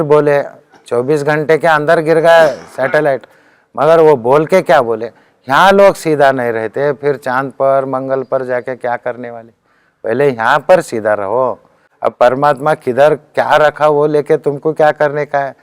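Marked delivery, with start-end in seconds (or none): none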